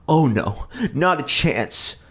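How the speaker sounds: high, loud, slow refusal; mother refuses the businessman angrily; angry mother. A narrator's reading. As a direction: bark it at the man. Mom storms out angry.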